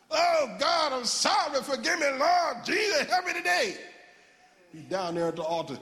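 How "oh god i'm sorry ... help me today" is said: high, wailing church repentance; loud repentance. Retold or imitated. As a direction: cry out to God in hammed-up remorse. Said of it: "repent was loud".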